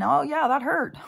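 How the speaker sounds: high, loud sarcastic complaint; mock the painless claim; high mock protest; mock victim. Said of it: "coming out and saying".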